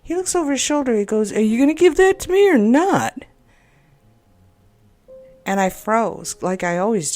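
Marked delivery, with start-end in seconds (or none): 1.3-3.2 loud, higher, irritated demand; retold angry yell. Mailman snapping at the woman holding his mail, loud and irritated. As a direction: bark it over your shoulder like an annoyed mailman. Mailman turns and snaps at her.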